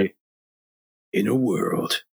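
deep trailer narrator; movie trailer voice. Retold or imitated. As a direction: epic trailer announcer. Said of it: "Can I do that voice?".

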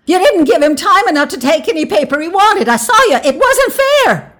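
very high, loud outcry; shouted protest. A narrator's reading. Wife shouts at the official, outraged. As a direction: shriek the accusation, dragging out the end. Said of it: "Tessie Hutchinson shouted to Mister Summers".

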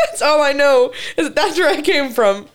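words spilling out in a giggle; laughing through words. Real, not acted. Telling her friend about lost directions, laughing. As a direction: giggle while you say it.

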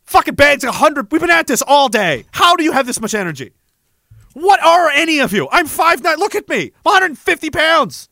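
worked-up joking rant at tall teammates; exasperated rant. Retold or imitated. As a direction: marvel at them, half laughing, half outraged.

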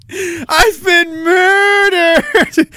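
high, drawn-out mock cry; hammed-up wail. Streamer playing a murder victim, hammed-up high wail. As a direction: wail it very slowly like an overacting victim.

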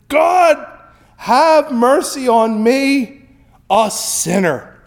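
high, slow, loud plea for mercy; pleading sinner. Retold or imitated. The tax collector begs God for mercy, pleading. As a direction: beg God slowly, broken. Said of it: "he beat on his breast and said".

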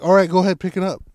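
loud, high prompting. Retold or imitated. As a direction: act out urging him to lift.

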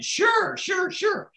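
high excited sure, sure; imitating eager lunch guests; eager yes. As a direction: jump at the offer eagerly.

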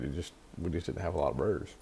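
talking between sips of coffee; words round a sip of coffee; while sipping a drink. Real, not acted. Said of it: "Sorry, I'm drinking coffee while I talk".

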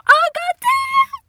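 high, loud drawn-out wail; hammed-up wail of parental despair. Parents despair at musician news.

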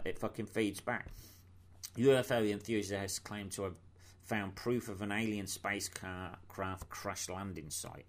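ordinary talk

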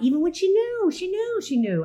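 KNEW pushed up high, then falling away; stress on knew. Real, not acted. To a friend about her daughter, hammering "knew". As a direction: lean hard on "knew" each time, rising then settling.

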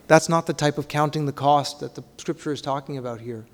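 normal talking voice

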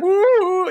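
high, drawn-out mock howl; wounded animal howl. Nicolas Cage stabbed from behind, imitated wounded howl. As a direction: howl like a hurt animal, hammed up. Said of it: "becomes like a wounded animal".

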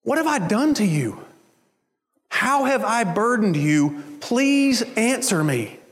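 slow, gentle pleading question. Shown as a demonstration. God pleading his case with his people, slow and gentle. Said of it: "Can you hear the way God is asking this question?".

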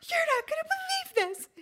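high, bubbling over with glee; can barely contain the surprise; giddy excitement. Retold or imitated. To the manager on the phone, high giddy excitement.

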